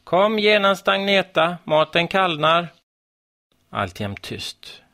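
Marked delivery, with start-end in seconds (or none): 0.0-2.7 high, loud call, insisting; mother calls the girl to dinner once more; calling out again. A narrator's reading. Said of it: "Mamma ropade igen". (högt, enträget rop)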